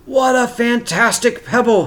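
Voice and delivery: high delighted exclamation; father delighted by a red pebble; exclaimed. Acted. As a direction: marvel at the find out loud. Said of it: "he exclaimed".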